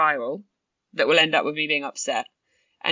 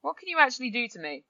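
higher, playful challenge to a spider; a mock-bold talk to a spider; 6 semitones higher than usual. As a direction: stare the spider down, half joking. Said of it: "If we actually then go".

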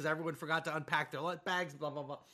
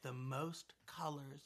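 put-on soft, slow whisper of a small child; whispered kid answer; 7 semitones lower, 14 dB quieter, slower than usual. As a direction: breathe it out like a secret. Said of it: "And he's like".

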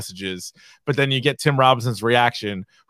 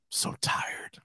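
whisper; 14 dB quieter than usual. Retold or imitated. Imitating sleepy character.

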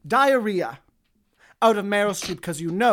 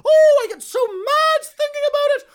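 mock outrage; 5 dB louder than usual. Mock rage as Julia Child.

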